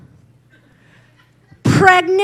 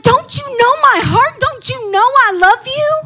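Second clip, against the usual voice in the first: higher, pleading hurt questions; 8 semitones higher, 4 dB louder than usual. Retold or imitated. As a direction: plead with the people who left. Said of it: "I was mad at people".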